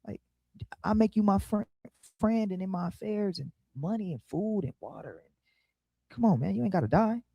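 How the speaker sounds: soft, coaxing hush; king begging the son softly, hushed and coaxing; hushed pleading king. Retold or imitated. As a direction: plead with him quietly, like a secret deal. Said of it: "he like".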